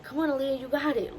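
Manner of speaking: lilting retold pep talk; cheering on. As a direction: imitate a nurse cheering a patient on. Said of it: "trying to cheer me up".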